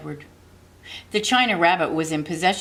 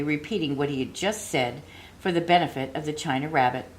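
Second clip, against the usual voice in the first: plain reading voice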